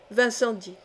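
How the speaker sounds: ordinary delivery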